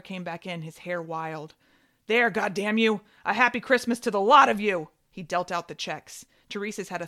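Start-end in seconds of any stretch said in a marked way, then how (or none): 2.1-4.9 furious shout. Acted. Father throws checks angrily.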